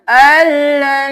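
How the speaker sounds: slow drawn-out الن; spelled-out blend. Shown as a demonstration. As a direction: join the letters slowly into الن. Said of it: "حمزہ زبر لام ال".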